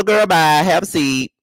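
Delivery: usual speaking voice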